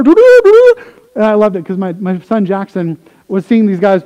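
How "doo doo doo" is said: high, loud tooting horn sound; mimicking a shofar blast at worship; shofar imitation. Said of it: "People with a random shofar".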